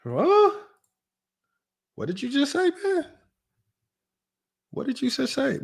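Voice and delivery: high-pitched, incredulous questioning; mock disbelief. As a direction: squeak out your baffled disbelief. Mock disbelief at clip's words.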